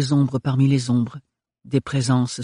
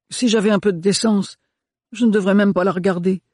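rising, louder and faster manservant's protest; agitated Renard; 6 semitones higher, 4 dB louder than usual. Acted. Renard, gêné, se justifie devant Ophélie, de plus en plus fort. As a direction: blurt it out, ashamed and worked up.

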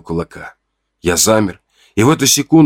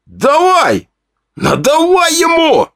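much higher, slowed crowd cry urging him on; cheering yell; slower than usual. Acted. A henchman shouts at Fedya to pile on, cheering. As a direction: rile up the fighter.

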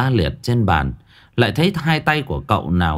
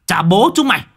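much higher, louder and fast, roaring curses; furious yelling; 10 semitones higher, 3 dB louder, faster than usual. A narrator's reading. Medium screaming at the ghosts at his gate, furious yell. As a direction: slam the table and bellow at the spirits. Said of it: "Cậu nghiến răng đập bàn đánh thình một cái".

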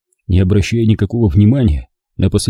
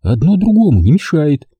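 objecting; 7 semitones higher than usual. A narrator's reading. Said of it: "возразила Зина".

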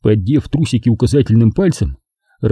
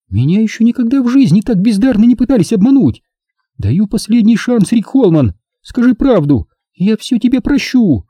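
high-pitched, mildly raised woman's outcry; crying out; 11 semitones higher than usual. A narrator's reading. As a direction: give an indignant feminine ultimatum. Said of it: "закричала она". (возмущённый женский крик, вполсилы)

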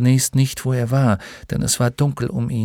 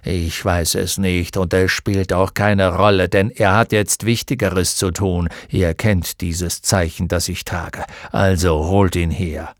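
stern centaur; 5 semitones lower than usual. A narrator's reading. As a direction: insist with weary authority. Centaur insisting on Atreyu.

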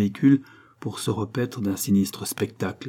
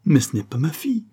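higher and a bit louder, sudden denial; startled exclamation; 6 semitones higher, 4 dB louder than usual. Acted. Mother at the body, startled denial. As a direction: blurt it out in disbelief. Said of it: "s'exclama-t-elle".

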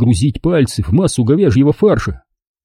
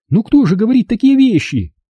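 indignant; 11 semitones higher than usual. Acted. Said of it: "возмутилась мама".

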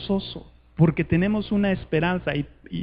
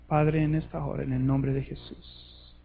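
soft prayer; 4 semitones lower, 7 dB quieter than usual. Acted. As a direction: pray softly for the congregation. Leads closing prayer.

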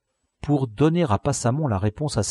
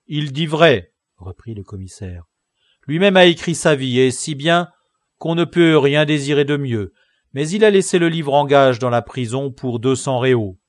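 mocking tone; 4 semitones higher, 7 dB louder than usual. Commissaire mocks convict's book.

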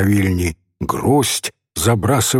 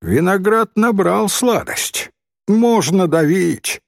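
higher, slow boy playing the expert; drawn-out knowing child's judgement; self-important connoisseur; 8 semitones higher, slower than usual. A narrator's reading. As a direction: pronounce a verdict like a little expert. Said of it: "сказал мальчик с видом знатока".

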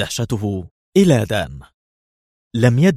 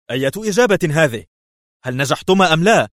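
much higher, fast, louder; sharp cry; 7 semitones higher, 4 dB louder, faster than usual. A narrator's reading. The ambassador loses patience on the phone, sharp. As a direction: bark the demand. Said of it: "هتف في حدة".

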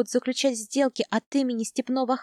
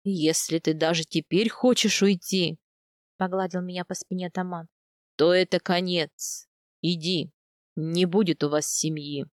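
lower, steady voice of a kind steppe khan; khan's voice; 5 semitones lower than usual. A narrator's reading. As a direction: speak low and earnest as the old khan. Khan speaks again. (пониженный голос хана, серьёзно и по-доброму)

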